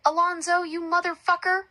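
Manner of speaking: high, loud heckle from a crowd; angry yell. A narrator's reading. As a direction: yell it out from the mob. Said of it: "Someone from the angry crowd of people yelled".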